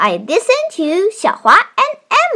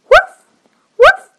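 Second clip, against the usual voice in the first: high, loud little puppy yips; high puppy bark; 6 dB louder than usual. Acted. Puppy barks in story.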